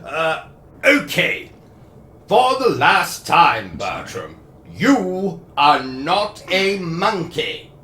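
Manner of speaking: put-on posh British accent, scolding; a pompous host telling off Bertram.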